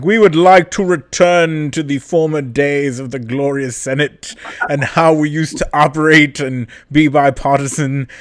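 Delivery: put-on high, hammy old-timey senator; grand old-fashioned speechmaker. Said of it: "this old-timey voice".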